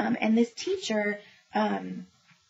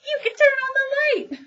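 put-on much higher, louder child voice; bright child punchline; 7 dB louder than usual. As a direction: land the punchline as the proud boy. Boy's triumphant punchline.

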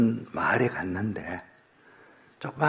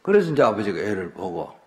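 ordinary delivery

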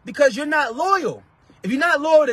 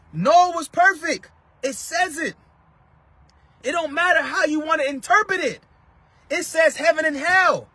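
ordinary delivery

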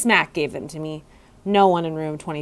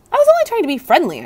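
higher, louder, fast flustered squeak; startled protest; 10 semitones higher, 6 dB louder, much faster than usual. A narrator's reading. Startled hamster protesting to the frog, flustered. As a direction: blurt it out backing away.

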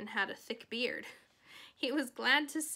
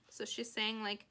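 ordinary delivery, nothing marked

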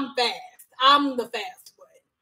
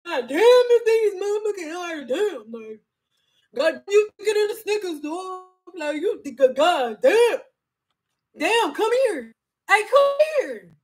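catcalling men; 7 semitones higher than usual. Retold or imitated. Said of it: "All men was like".

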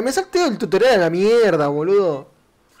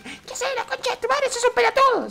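agudo y burlón, imitando a un espectador que lo insulta. Retold or imitated. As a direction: remeda a un fan que se burla de él. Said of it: "va a decir". (imitación aguda y burlona de un espectador)

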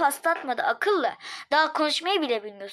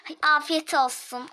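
normal talking voice

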